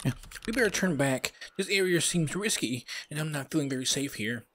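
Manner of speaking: high-pitched worried sidekick; high put-on voice of a nervous buddy; Bob. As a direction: pitch it up, uneasy and wanting to turn back. Voices Bob, wary of the area.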